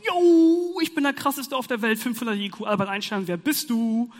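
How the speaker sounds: strut like you're the smartest alive; cocky braggart. Retold or imitated. Student mocking himself after an exam, loud cocky brag. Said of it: "denken wir uns".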